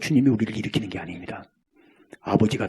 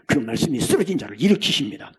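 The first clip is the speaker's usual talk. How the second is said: ordinary talk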